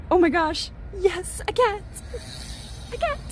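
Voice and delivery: a real catch of tears in the voice; choked up with happy tears; tearful. Real, not acted. Streamer moved by the giant mosaic, tearful.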